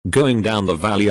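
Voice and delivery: usual speaking voice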